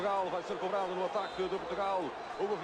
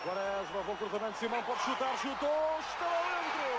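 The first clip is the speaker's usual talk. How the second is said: excited goal call, rising high; fast dramatic football commentary; 6 semitones higher than usual. Real, not acted. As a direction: shout the goal, building fast. Live Portuguese goal call.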